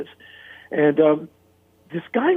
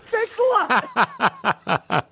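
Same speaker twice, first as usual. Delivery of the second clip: higher, very slow, dry mock gratitude with a laugh; sarcastic thanks; 9 semitones higher, much slower than usual. [name] answering [name]'s record-cover insult, slow sarcastic thanks. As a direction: drag out the thanks, laughing at the jab.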